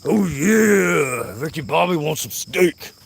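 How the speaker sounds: high put-on voice, stretched yeah; hammed-up, drawn-out craving; Ricky Bobby. Vlogger playing a steak-craving film character, hammed-up high voice.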